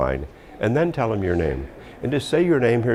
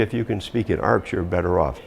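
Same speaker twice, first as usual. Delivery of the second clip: ordinary talk, nothing marked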